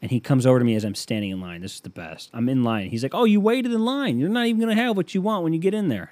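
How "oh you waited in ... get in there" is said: high, taunting impression of the dad; mocking dad voice. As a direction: sneer at him like a smug dad. Dad mocking him in line.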